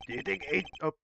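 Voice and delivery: usual speaking voice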